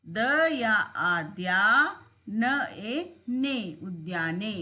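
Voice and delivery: very slow, joining letters into उद्याने; slow classroom spelling; slow syllable building. Shown as a demonstration. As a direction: spell it out piece by piece for beginners. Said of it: "इसी प्रकार बनेंगे".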